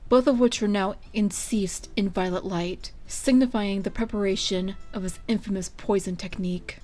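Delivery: ordinary delivery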